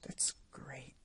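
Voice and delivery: breathy unvoiced whisper. Shown as a demonstration. Streamer whispering along with the line. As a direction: whisper it, barely any sound. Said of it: "He was speaking in a whisper".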